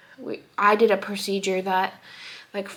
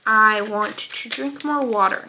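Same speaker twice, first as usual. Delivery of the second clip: ordinary talk, nothing marked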